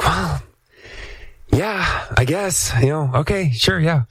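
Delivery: grudging reluctance. Retold or imitated. Frustrated guest answering a reporter's call, reluctant and grudging. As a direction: play the reluctant, put-upon yes. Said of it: "frustrated and not in a great mood".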